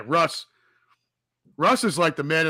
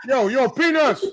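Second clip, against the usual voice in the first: higher vendor's call, laughing; laughing peanut vendor; 11 semitones higher, 4 dB louder, slower than usual. Retold or imitated. Mocking the Yankee Stadium vendors, laughing call. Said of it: "the peanut vendors at Yankee Stadium".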